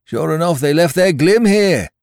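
loud, high pirate report; high-pitched hail; calling from the window. A narrator's reading. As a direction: shout a discovery down to the chief. Fellow calls from window.

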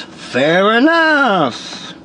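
very slow, drawn-out comic voice; hammed-up comedian impression, long vowels; Buddy Hackett. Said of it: "And Buddy goes".

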